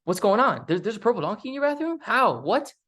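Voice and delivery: high, fast, rising disbelief; astonished friend. Retold or imitated. Best friend hearing the donkey news, astonished. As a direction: pile up the questions in amazement. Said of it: "they're gonna be like".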